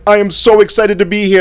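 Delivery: hammed-up stage speaker gushing; mock hyped speaker. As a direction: parody an overeager keynote opener. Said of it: "you see people on stage".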